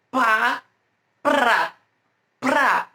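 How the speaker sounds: "pra" isolated, P popped and R tapped distinctly; P e R separados. Shown as a demonstration. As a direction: demonstrate "pra" slowly, pausing between repeats. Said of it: "Eu pronuncio o P". ("pra" isolado, com o P e o R bem marcados)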